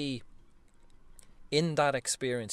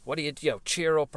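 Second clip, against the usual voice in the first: raised, dismissive cheer up; impatient scold; 5 semitones higher, 5 dB louder, faster than usual. Pretend. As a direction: brush the kid off loudly. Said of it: "I'm not gonna shout at him".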